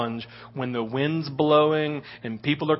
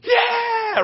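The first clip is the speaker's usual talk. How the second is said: high, joyful shout of triumph; cheering yell. Real, not acted. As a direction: whoop it out like you just landed. Cheering after landing the fall.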